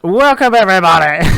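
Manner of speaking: loud, high show-opener shout; big intro welcome. Acted. Co-host starting the podcast intro, big loud welcome. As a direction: burst in as an over-keen host. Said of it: "how about I start the intro then".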